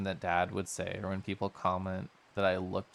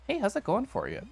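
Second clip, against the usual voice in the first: high, eager friend voice, loud; high curious friend; 11 semitones higher, 4 dB louder than usual. Acted. Friend asking about the pitch, high and eager. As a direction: perk up like a nosy friend. Voices a game character scene.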